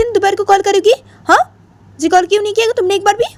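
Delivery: put-on high girlish pout, nagging; Jiya's complaining voice. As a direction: play the upset friend in a very high voice. Jiya scolds Ritu on phone.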